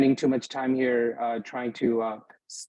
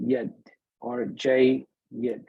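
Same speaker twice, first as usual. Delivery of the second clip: jayyid broken in two, "jay", pause, "yid"; doubled ya split; much slower than usual. Shown as a demonstration. Teacher showing two yas in a shadda, jayyid split by pauses. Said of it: "it's two yas".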